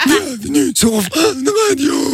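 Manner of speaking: put-on inhaled old man voice; talking while inhaling. Shown as a demonstration. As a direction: speak slowly while breathing in. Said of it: "c'est plus Père Fouras".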